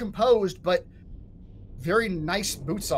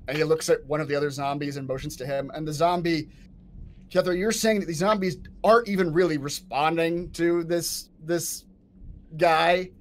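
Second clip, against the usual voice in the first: ordinary talk, nothing marked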